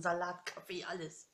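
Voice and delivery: normal talking voice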